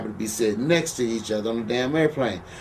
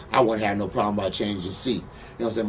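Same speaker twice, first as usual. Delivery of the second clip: ordinary delivery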